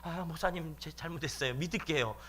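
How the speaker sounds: acted cowed young man; scared apology. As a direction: give in hastily as if intimidated. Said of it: "너무 무서워가지고".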